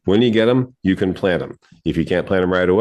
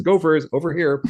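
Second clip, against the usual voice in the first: mock sign calling gophers; 9 semitones higher than usual. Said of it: "a salad buffet sign that says".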